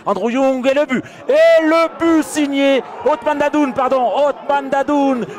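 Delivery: excited sports commentary. Real, not acted. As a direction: roar the goal with rising pitch. Goal call by commentator.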